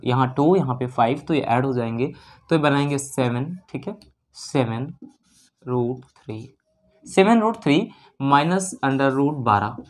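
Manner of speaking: usual speaking voice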